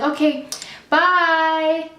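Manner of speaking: imitated sarcastic farewell, high drawn-out last word; sarcastic send-off. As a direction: mimic a dismissive cashier, sing-song and fake-polite. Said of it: "you know how, like, sarcastic".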